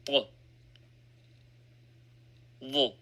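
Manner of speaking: usual speaking voice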